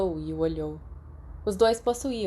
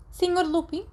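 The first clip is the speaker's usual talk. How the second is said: McGonagall's curt, higher voice; brusque; 11 semitones higher than usual. A narrator's reading. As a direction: clip his name like a teacher about to give detention. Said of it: "daquela maneira brusca". (voz brusca de professora)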